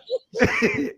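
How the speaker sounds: short, high giggle burst; high, involuntary laugh. Real, not acted. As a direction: crack up mid-sentence. Breaks up while trying to be serious.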